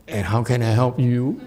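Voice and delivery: chuckling through the question; can't hold back a happy laugh. Retold or imitated. Teller offering help to the rich homeless man, laughing. Teller delighted by ten thousand.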